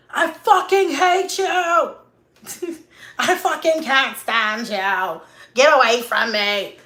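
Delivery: mock furious shout, loud and high; drawn-out, high-pitched yell of disgust; hateful yelling. Parent lashing out at the kids, hateful shouting.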